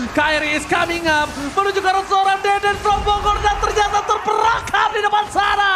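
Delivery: loud, high hype play-by-play; fighting game announcer. Acted. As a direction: call the teamfight at full hype. Hype teamfight call.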